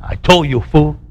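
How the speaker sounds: low-pitched, understated scorn; mock disdain. Imagined Jesus looking at Peter, mock disdain. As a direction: lightly imitate a disdainful I-told-you-so. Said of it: "looking at him with disdain".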